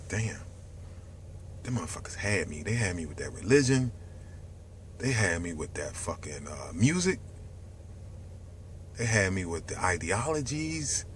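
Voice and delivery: soft, low, hushed confession; hushed realisation. Retold or imitated. Voicing the viewer waking up to the system, hushed. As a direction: murmur it like waking up. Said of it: "Then you be like".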